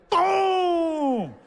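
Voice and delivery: a very high, loud yell; punch shout. Retold or imitated. Fighter throwing his hardest punch shouts boom.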